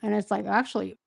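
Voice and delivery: scratchy, worn-out voice; losing voice. Real, not acted. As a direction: talk with a sore, fading voice. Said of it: "my voice is, like, totally gone".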